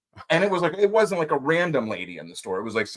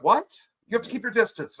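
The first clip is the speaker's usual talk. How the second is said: incredulous; 12 semitones higher than usual. Retold or imitated. Retold incredulous reply.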